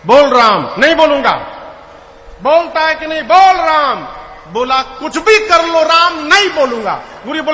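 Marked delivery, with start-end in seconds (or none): 0.0-0.7 louder, stern order; stern command. Acted. Guru scolds the son. 0.8-1.3 higher, louder defiant refusal of "नहीं बोलूंगा"; defiant shout. Acted. Son shouts his refusal. 2.4-4.0 higher, louder furious command; angry order. Acted. Guru shouting at son. 4.8-7.0 higher, louder stubborn defiance; defiant shout. Acted. Son defiant.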